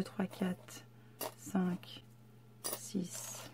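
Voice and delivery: chuchotement très bas, sans voix; compte chuchoté. Real, not acted. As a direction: compter pour soi, à peine audible. Compte les pièces pour elle-même. (chuchoté en comptant)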